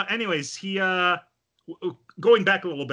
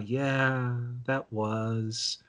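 softly trailing, absent-minded agreement; distracted; 2 semitones lower, 5 dB quieter, much slower than usual. Distracted friend half-answering while not paying attention, absently. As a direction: answer with your mind elsewhere. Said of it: "she's not paying attention".

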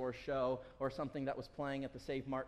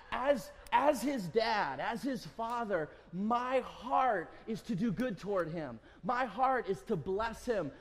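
preaching; 5 semitones higher, 4 dB louder than usual. Acted. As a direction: drive it home to the congregation. Preaching climax on God's goodness.

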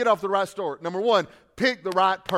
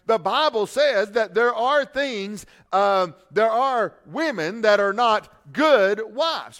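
rising pulpit voice with punched words; preaching; 3 semitones higher, 3 dB louder than usual. Acted. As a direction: drive the point home to the church. Preaching the sermon.

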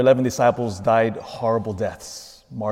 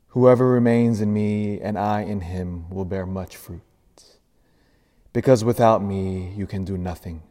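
gospel reading; 2 semitones lower than usual. Acted.

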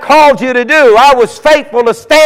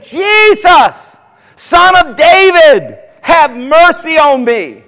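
begging cry for mercy, higher; 7 semitones higher, slower than usual. Acted. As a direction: call out across the crowd. Blind man crying out to Jesus.

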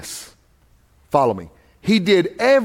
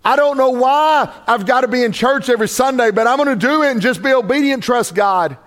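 building preaching; 8 semitones higher than usual. Acted. Voicing obedient believers, building up.

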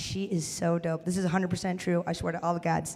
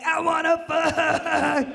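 higher, very slow dopey drone; dumb dude voice; 8 semitones higher, 4 dB louder, much slower than usual. Retold or imitated. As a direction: parody a clueless bro. Mocking a dude's thinking.

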